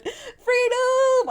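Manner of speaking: a high, loud hammed-up freedom cry; mock patriotic cheer. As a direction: belt it like a parody American. Celebrating freedom mockingly.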